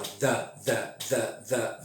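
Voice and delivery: "the" with the tongue between the teeth and a buzz; "the" with a voiced TH, not D. Shown as a demonstration. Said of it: "Língua entre os dentes".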